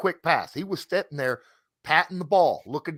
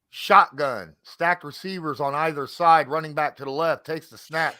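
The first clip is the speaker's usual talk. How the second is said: play-by-play; 3 semitones higher, 4 dB louder than usual. Acted. Calls the play live.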